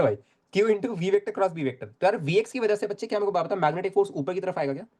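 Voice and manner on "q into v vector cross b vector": slow, word by word; slow dictation. Shown as a demonstration. Teacher repeating the formula for the class, slowly. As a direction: drag the formula out for students. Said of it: "teacher restating formula slowly".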